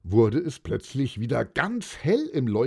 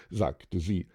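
ordinary delivery

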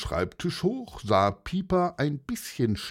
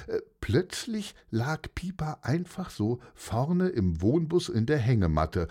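usual speaking voice

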